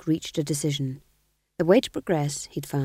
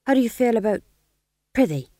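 higher, eager jester patter; jester fool; 6 semitones higher, 5 dB louder, faster than usual. Acted. Fool replying to the Duke in jester talk, higher. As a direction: chirp it like a court fool.

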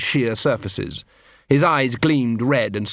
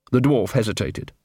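ordinary delivery, nothing marked

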